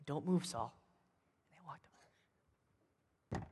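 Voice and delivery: very soft, sneaking-up voice; creeping, near-silent storytelling; hushed narration. A narrator's reading. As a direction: tell it softly as they creep up. Sneaking up on Saul.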